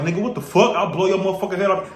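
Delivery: lightly voiced street tough, mocking; mock thug voice. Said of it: "some talk like".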